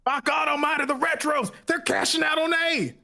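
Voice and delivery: put-on high, excited old-timer hollering; old prospector. Shown as a demonstration. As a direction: holler like a wild old miner. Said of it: "You sounded like an old prospector".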